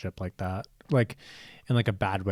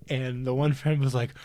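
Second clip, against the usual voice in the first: normal talking voice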